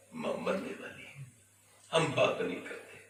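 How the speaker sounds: soft, breathy near-whisper; hushed rebuke. Real, not acted. Preacher to congregation, hushed rebuke. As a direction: drop to a hush to sting. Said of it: "preacher drops to hush".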